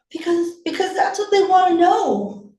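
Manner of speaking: put-on high, loud kid's retort; defensive child. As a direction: protest like a cornered boy. Son defends himself to mother.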